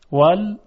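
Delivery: وال cut off with a clear glottal stop; hamza stressed. Shown as a demonstration. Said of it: "این همزه هست". (وال با تأکید روی همزه)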